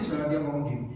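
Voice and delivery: usual speaking voice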